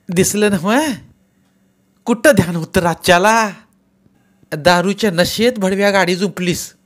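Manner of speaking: high, loud scolding barrage; angry tirade. A narrator's reading. Aunt berating the drunk driver, loud angry scolding. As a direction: berate him without pause. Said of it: "गंगवाचा पट्टा सुरू झाला".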